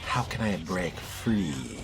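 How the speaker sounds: ordinary delivery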